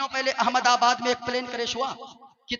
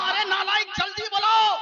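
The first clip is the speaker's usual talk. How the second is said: angry king's shout; 9 semitones higher than usual. Acted. Sick king shouting at his vizier, high and furious. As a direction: bark the order like an enraged king.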